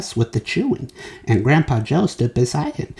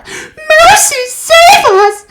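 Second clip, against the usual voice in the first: very high, loud wailing yell; 10 dB louder, much slower than usual. A narrator's reading. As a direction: wail to the heavens. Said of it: "yelled Missus Beauregard".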